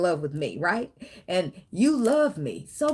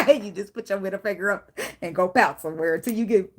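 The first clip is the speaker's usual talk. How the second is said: usual speaking voice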